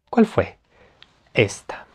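normal talking voice

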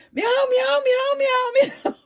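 high, loud imitated meows; imitated cat meows with a laugh breaking in; meowing cat. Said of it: "And then all of a sudden".

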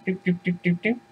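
high, soft doop-doop steps; tiny pattering hoof noises, high and quiet; light trotting noise. Acted. Little goat trots off across bridge, dainty doops.

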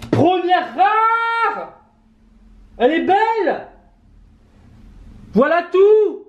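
high-pitched squeal of joy over a rare card; excited cry. Real, not acted. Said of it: "Ah, j'ai crié".

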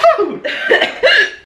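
a squeaky high-pitched giggle. Real, not acted. Laughing fit after teacup joke.

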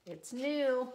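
ordinary delivery

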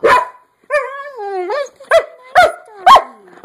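squeaky high-pitched and loud; high-pitched pet talk. Real, not acted. Squeaky high dog praise.

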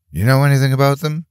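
high, fast challenge; husband demanding answers from the stranger, high and fast. A narrator's reading. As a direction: fire the question at him, demanding. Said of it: "he demanded".

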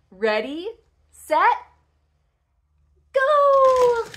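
suspenseful pauses, bursting into a high shout; building countdown. Pretend. Launching a toy car with a child, building countdown. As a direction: build from "Ready" to a long, loud "go".

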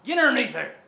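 high, loud gym call; shouted order. Real, not acted. Coach sending lifter under the bar, shouted order. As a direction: yell it as a command.

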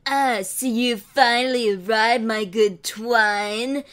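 sinister slow greeting; evil villain. Acted. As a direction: savour the arrival like a cat. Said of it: "Asaram lulled with evil".

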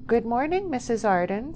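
high, prim, proper greeting. A narrator's reading. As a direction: greet her primly, pitched well up. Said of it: "said Lucy Thruckton primly".

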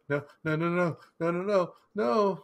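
begging as the gun turns toward him; rising mock terror; panicked pleading. Man turning gun on himself.

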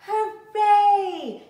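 high, loud joyful hooray; a loud, delighted shout; excited cheer. Acted. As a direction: cheer like a child who can't wait. Child runs happily to school.

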